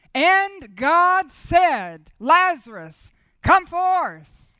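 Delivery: very slow, loud, thundering proclamation; preaching at full force. Acted. Preacher proclaiming God's command, loud and very slow. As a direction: thunder it out word by word.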